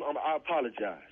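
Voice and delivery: ordinary delivery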